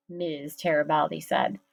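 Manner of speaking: ordinary delivery, nothing marked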